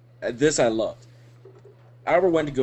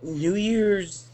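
higher, halting mock-drunk rambling; imitating drunk friend; 6 semitones higher, much slower than usual. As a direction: lose the thread with long tipsy pauses. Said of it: "you were so fucking drunk".

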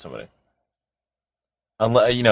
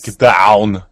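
mock Arnold Schwarzenegger action yell; hammed-up Austrian action-star yell; Arnold-ish shout; 6 dB louder than usual. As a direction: bark it like an Arnold action hero. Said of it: "it'll be all Arnold-ish".

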